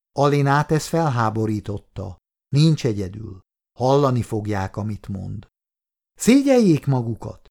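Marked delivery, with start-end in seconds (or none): 6.1-7.5 higher, louder cry of shame; indignant shout. A narrator's reading. Nurse shouting at soldiers, indignant and higher. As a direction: shame them out loud. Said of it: "kiáltott a lány".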